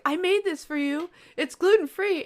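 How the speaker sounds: high mock sweet offering voice; imitating a teammate. Teammate offering homemade food, high sweet voice. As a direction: put on a sugary, eager offering. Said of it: "and they'll be like".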